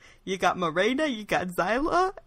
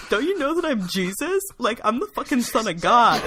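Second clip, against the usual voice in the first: high, loud outraged rant; indignant Jesus; 5 semitones higher, 5 dB louder than usual. Acted. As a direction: play an offended Jesus, loud. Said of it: "Jesus was really pissed".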